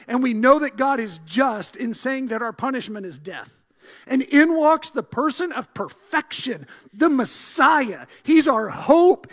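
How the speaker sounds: rising, insistent pulpit fervor; preaching at full force. Acted. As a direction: preach it hard to the congregation.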